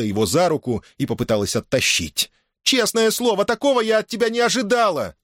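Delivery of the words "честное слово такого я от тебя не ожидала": high-pitched shocked reproach; indignant mother. A narrator's reading. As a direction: scold the son in a high voice. Mother scolding her brawling son. (возмущённый упрёк матери)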